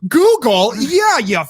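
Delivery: high, loud, slow taunting; mocking taunt.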